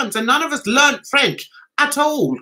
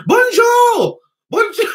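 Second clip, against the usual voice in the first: high squeaky bonjour, waving; excited wrong goodbye; 12 semitones higher, 6 dB louder than usual. Retold or imitated. Tourist saying bonjour instead of goodbye, high and excited. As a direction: blurt bonjour brightly as you leave. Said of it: "And I was like".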